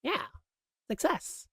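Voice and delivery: high, airy goofball voice; chirpy sitcom oddball; Chit's catchphrase. Retold or imitated. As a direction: squeak it out like a clueless office guy. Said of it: "He says things like".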